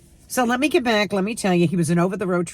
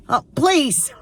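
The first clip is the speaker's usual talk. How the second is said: scoffing; 8 semitones higher, 4 dB louder than usual. Real, not acted. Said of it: "scoffing at honeymoon idea".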